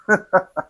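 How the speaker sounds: short dry chuckle; wry chuckle. Acted. Old party worker mocking lost seats, wry chuckle. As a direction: chuckle briefly at the absurdity. Laughing at the party's seating irony.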